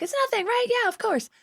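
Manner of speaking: put-on high, naive little-girl voice; innocent little girl. Imitating her innocent younger self, high and naive. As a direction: play the hopeful innocent girl. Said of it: "innocent little girl".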